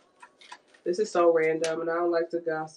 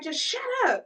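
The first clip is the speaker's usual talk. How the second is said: high-pitched, louder mock outburst; retold scolding, pitched way up; exasperated; 5 dB louder than usual. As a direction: imitate a woman who's had enough. Woman scolding crying man, retold again.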